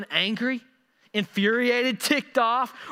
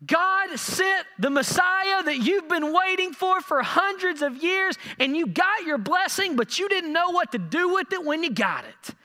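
preaching shout; 9 semitones higher than usual. Acted. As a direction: thunder it at the crowd as Peter. Preacher voicing Peter rebuking crowd.

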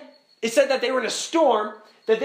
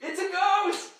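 high-pitched cry of alarm; hammed-up panic, pitched way up; mock fearful cry; 9 semitones higher than usual. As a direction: imitate terrified men crying out. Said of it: "In fear, they cry out".

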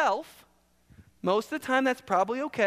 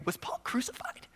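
hushed mock astonishment; breathless whispered disbelief; stunned whisper; 4 semitones higher, 8 dB quieter than usual. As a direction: whisper it as a shocking discovery. Gullible reader stunned by the idea.

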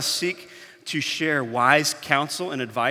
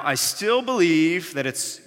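ordinary delivery

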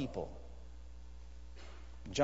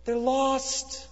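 much higher and louder, anguished; tearful plea; 12 semitones higher, 3 dB louder, slower than usual. Retold or imitated. Missionary pointing at his globe, in tearful anguish. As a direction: cry it out with grief. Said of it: "with tears coming down his face".

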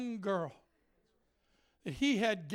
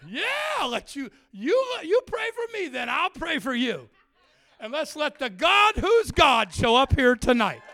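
loud, high preacher's challenge; bold challenge; 5 semitones higher, 9 dB louder than usual. Retold or imitated. Preacher dares the girl to pray, loud challenge. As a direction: throw down the gauntlet at full voice. Retold challenge to a prayer contest.

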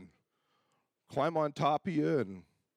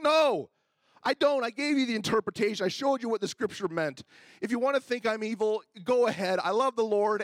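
heated retort; 7 semitones higher, 5 dB louder than usual. Retold or imitated. Pastor's heated reply to accuser.

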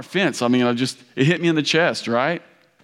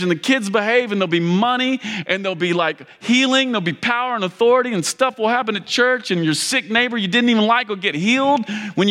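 higher, fervent preaching voice; 6 semitones higher than usual. Acted. Pastor presses the story's lesson on the congregation, preaching. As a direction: lift it and preach to the room.

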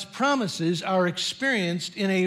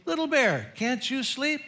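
gently higher-pitched story-bear question; a light touch of coaxing papa, pitched up; lightly voiced Papa Bear; 6 semitones higher than usual. A narrator's reading. Papa Bear checks on wakeful Little Bear, lightly voiced parental question. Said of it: "Papa Bear would pad over and say".

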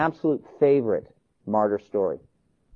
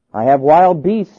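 louder, slow warning; threat; 7 dB louder, slower than usual. A narrator's reading. Proconsul threatening the old man, forceful warning. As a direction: threaten slowly and loudly.

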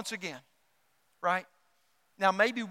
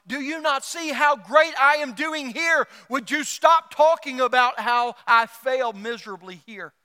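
mock yell at God; 3 semitones higher, 8 dB louder than usual. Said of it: "we will yell at God going".

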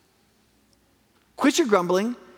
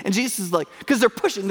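normal talking voice

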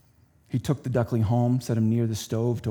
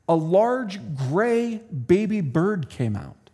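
put-on reading to children; 4 semitones higher, 3 dB louder than usual. Reads a children's story.

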